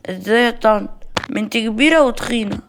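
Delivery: lightly voiced insolent child; cheeky child. Child teases Maria.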